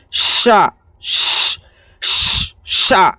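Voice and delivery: "স" as long airy sh; rounded-lip shin. Shown as a demonstration. Teacher demonstrating big shin, drawn-out sh on "স". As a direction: round lips, push air through "সা" as sha. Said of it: "হোঠটা গোল করে বলতে হবে".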